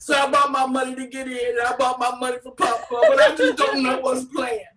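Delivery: high, loud, mock-crying plea; mock tearful mom. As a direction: fake a sad sob story, laughing underneath. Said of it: "she goes".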